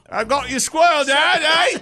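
high, loud Australian dog voice; the dog bragging in an Australian accent. Pretend. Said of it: "doing the Australian accent".

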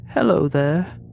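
high, lilting greeting; sing-song. A narrator's reading. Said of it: "she called in a sing-song tone".

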